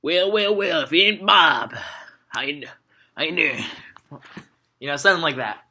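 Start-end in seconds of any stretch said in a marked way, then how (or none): 0.0-3.6 cowboy drawl, Western accent greeting; country Western accent. Shown as a demonstration. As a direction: play a folksy Western cowboy with a twang. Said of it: "I wanted to do a country Western accent".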